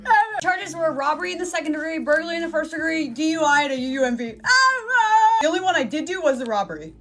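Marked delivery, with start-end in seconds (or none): 0.0-0.4 high, loud mock wail; acted scream. Acts reaction to stacked charges. 4.4-5.4 loud, high, held-out mock wail; acted scream. Acts reaction to stacked charges.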